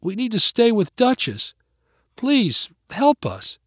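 put-on high, pleading little-boy voice; soft tearful plea. A narrator's reading. Boy wishing on the stars for help, high pleading. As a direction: plead high, like a child near tears. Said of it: "wet eyes and said softly".

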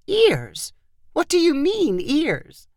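high, baffled questioning; puzzled surprise. A narrator's reading. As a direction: query it in disbelief, voice leaping up. Grandmother puzzled by the children's complaint.

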